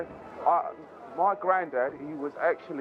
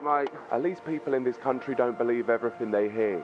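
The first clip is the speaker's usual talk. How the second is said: usual speaking voice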